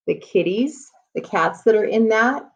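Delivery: normal talking voice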